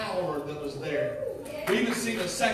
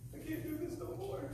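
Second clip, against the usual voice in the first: soft despair; 10 semitones higher, 12 dB quieter than usual. Acted. Repeated despairing line.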